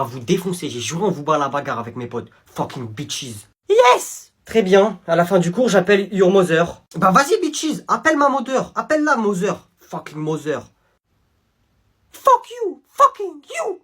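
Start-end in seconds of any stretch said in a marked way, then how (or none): 2.5-3.5 1 slow muttered insult. Acted. Pupil insulting softly. 3.7-4.3 much higher, louder Yes; teacher gloating at the pupil, high and loud; triumphant yelp. Acted. 12.1-13.8 much higher, loud fuck you; shrieked insults. Acted. Pupil screaming insults.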